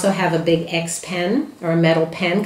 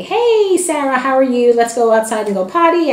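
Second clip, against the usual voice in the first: bright, brisk hello to the puppy, pitched higher and louder; matter-of-fact greeting; 7 semitones higher, 4 dB louder than usual. Shown as a demonstration. Trainer coming home, greeting the crated puppy: higher, louder, matter-of-fact. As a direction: greet the puppy lightly, no fuss. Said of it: "And I'm very matter of fact".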